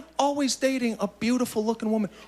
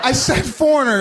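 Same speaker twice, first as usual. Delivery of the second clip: defiant repeat; 5 semitones higher, 6 dB louder than usual. Real, not acted. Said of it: "look at how quiet it just got".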